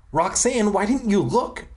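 high, exasperated cry. A narrator's reading. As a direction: cry out in mild dismay, voice lifted. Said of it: "I cried".

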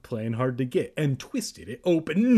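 plain reading voice

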